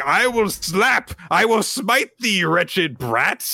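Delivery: high, loud, slow archaic villain; hammy threat. Retold or imitated. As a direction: declaim it like a melodrama witch. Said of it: "she comes in with".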